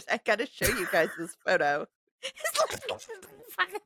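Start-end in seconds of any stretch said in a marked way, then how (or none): 2.2-3.8 squeaking it out through laughter; high voice, overcome with real laughing; helpless laughter. Real, not acted. Cracking up repeating co-host's joke.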